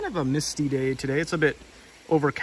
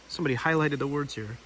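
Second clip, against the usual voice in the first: ordinary delivery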